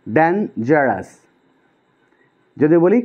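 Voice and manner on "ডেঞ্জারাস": ডেঞ্জারাস slowly, split into separate syllables. Shown as a demonstration. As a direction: break ডেঞ্জারাস into ডেন, জারাস with a clear gap. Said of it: "একের বেশিবার নড়বে".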